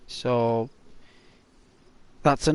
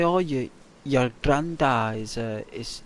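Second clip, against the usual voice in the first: ordinary talk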